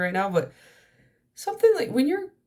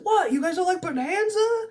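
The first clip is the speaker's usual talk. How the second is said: Kramer-style character bit; 14 semitones higher than usual. Retold or imitated. Movie character protesting to his kidnappers, high and indignant. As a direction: squeal it like a kidnapped man baffled by his captors. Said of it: "He's like".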